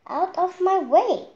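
high, loud yell to clear the path. Acted. Chick yelling at Henny Penny to move aside. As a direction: shout her aside. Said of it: "yelled Chicken Li".

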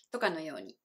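usual speaking voice

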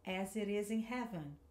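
smoother, words run together; teacher showing connected speech; linked words. Shown as a demonstration. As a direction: join the words into one flow. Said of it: "vamos ligar um pouquinho mais essas palavras".

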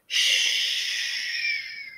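long high airy shh; wind whisper. Acted. Wind in the leaves whispering, a long high shh. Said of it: "It whispers".

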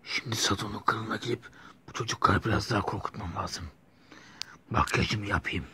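hushed villain gloat; scheming whisper. Acted. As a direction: breathe the plan out secretly. Villain plotting alone.

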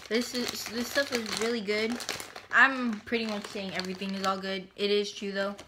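talking with food in mouth; chewing while talking. Real, not acted. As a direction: mouth partly full of food. Seen 1: Ate squid at -2; lips move in chewing shapes while speaking.

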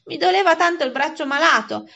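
acted tearful boy's complaint; whining. A narrator's reading. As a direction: whine pitifully about the hurt. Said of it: "risposi con voce piagnucolosa".

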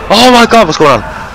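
usual speaking voice

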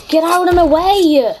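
loud, slow frantic yell; shouting. Real, not acted. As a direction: yell it at the other player. Frantic gaming, pushed around.

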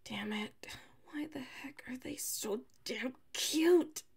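slow, breathy whisper to herself; hushed and flustered, slowed down. Acted. Girl whispering to herself about her roommate, flustered.

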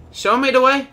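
loud, high battle cry; high shouted rallying call. Real, not acted. Calling out during game play.

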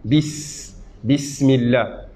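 Bis, Bismillah spoken slowly with the s air flowing; slow, hissing Bismillah; airy s. Shown as a demonstration. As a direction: draw out the hiss in Bismillah. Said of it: "vous sentez que l'air coule".

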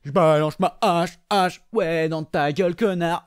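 taunting put-on voice for an axe thrower; sneering impression of a game enemy; mocking enemy voice. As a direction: play the gleeful troublemaker, stretching words.

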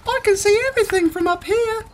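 high, fast mouse voice; excited mouse. A narrator's reading. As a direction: chatter like a thrilled little mouse. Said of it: "what she thought was".

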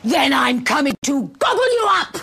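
loud, menacing troll roar. Acted. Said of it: "roared the troll".

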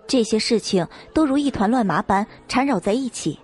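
ordinary delivery

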